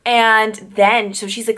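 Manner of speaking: ordinary delivery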